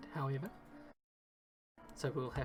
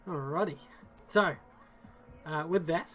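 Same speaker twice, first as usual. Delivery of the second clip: storytelling game master voice; game master narration; 7 dB louder than usual. Acted. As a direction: set the scene like a dungeon master. Game master describes the scene.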